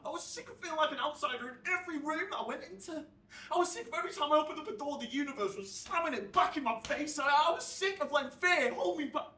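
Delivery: impassioned, high and increasingly loud; fed-up outburst. Acted. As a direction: build from frustration to a fast, loud rant. Narrator's fed-up outburst builds.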